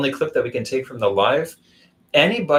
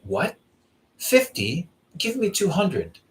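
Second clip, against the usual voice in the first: a higher, slower mock indignant haggle; mock angry demand; 8 semitones higher, slower than usual. Beggar scoffing at his fifty riyals, mock indignant and higher. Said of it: "He's like".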